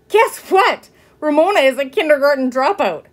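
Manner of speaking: high, loud gossipy sister; gleeful teasing. A narrator's reading. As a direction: announce it with mocking glee on the phone. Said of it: "she giggled into the telephone".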